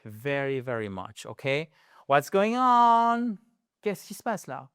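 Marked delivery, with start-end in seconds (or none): 2.1-3.4 slow, higher teacher demo; slow "What's going on" demo; slow model phrase. Shown as a demonstration. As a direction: lay it out slowly for learners. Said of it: "teacher models phrase slowly".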